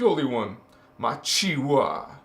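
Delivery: normal talking voice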